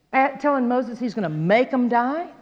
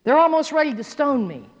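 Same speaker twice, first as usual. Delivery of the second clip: high, exasperated plea to God; raised-pitch appeal from an overwhelmed leader; crying out; 4 semitones higher than usual. Acted. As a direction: cry out in acted frustration. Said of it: "cried out to the Lord".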